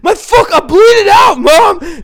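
high, loud mock scream; shrieked imitation of a panicking son; imitating hysterical friend. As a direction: scream to Mom in hammed-up panic. Hysterical friend retold on phone to mom.

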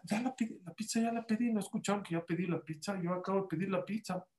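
teacher insisting he ordered, soft and deadpan; mock innocent teacher. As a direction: say it quietly as if nothing is odd. Teacher answers children innocently.

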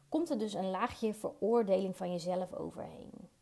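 usual speaking voice

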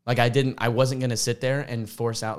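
usual speaking voice

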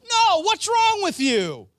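Paul with gusto. Retold or imitated. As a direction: shout it like a scandalised apostle. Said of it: "with a little more gusto".

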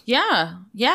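ordinary talk

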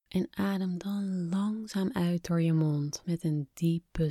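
hushed guided meditation voice; breathy, calm whisper guiding relaxation. Acted. Guided relaxation exercise begins.

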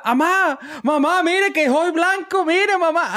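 put-on high-pitched child whining to his mother, slow; high kid voice calling mom. Boy shows off to his mother in a high, slow voice. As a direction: play the little boy begging mom to look.

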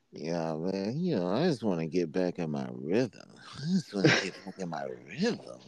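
mumbling B G imitation, low and soft; mouth full of peanut butter. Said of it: "Talking like I got peanut butter in my mouth".

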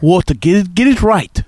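a hint of a mock Russian accent, correcting a friend; teasing Russian accent, lightly put on. Correcting David's beer talk, lightly done Russian accent. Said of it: "talking with the Russian accent".

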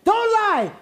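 high loud playful yell; shouting. Acted. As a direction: bark it at the crowd. Hype host yelling at audience.

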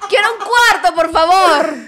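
mock demand. Joking she has three and wants another, mock demanding. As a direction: call it out loudly over the laughter. Joking announcement amid loud laughter.

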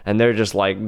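ordinary talk

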